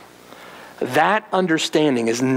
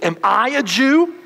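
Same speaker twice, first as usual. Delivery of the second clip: contemptuous retort; 8 semitones higher than usual. Retold or imitated. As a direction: sneer the question back at him.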